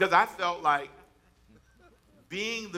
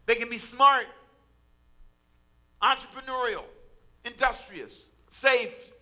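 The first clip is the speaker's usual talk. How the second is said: high, loud, emphatic preacher delivery; impassioned preaching; 7 semitones higher, 5 dB louder than usual. Real, not acted. Preacher urging women on about their potential, impassioned preaching. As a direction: preach it out forcefully, punching the key words. Preacher builds to forceful exhortation.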